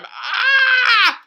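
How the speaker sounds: high excited yelp; a quick delighted cry. Real, not acted. Reacting to overtime highlight.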